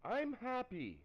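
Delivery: high, excited mock declaration. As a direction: gush it like an excited teen in love. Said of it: "she's like".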